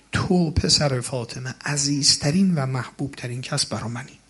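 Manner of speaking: ordinary talk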